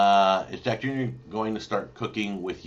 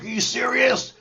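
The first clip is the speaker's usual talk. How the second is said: frustrated son; 7 dB louder than usual. Retold or imitated. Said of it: "He's like".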